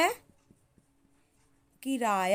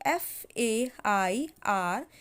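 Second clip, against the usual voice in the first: slow dictation spelling; 3 semitones lower, much slower than usual. Shown as a demonstration.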